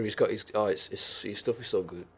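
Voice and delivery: ordinary talk, nothing marked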